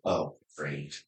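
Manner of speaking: low, soft deadpan "oh, great"; flat deadpan. Retold or imitated. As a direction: say it dry and unimpressed. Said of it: "She goes".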